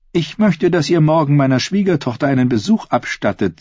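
high-pitched queen coaxing a man; persuasive scheming queen. A narrator's reading. Queen to her would-be killer, persuasive. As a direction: coax him in a lifted, lively voice.

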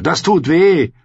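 loud, high, pained complaint; scolding. Acted. Gendry scolds Arya for hitting him, indignant. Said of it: "schimpfte er".

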